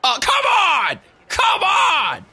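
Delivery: high exasperated yell; frustrated shout. Real, not acted. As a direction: protest loudly at the game. Frustrated at game event.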